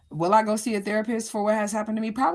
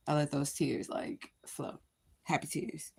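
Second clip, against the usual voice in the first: soft; 3 semitones lower, 8 dB quieter, slower than usual. Real, not acted. Tender talk of happy tears.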